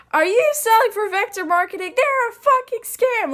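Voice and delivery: imitating a screaming sister on the phone. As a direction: scream it like a sister who hates the scam. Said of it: "screaming her brains out".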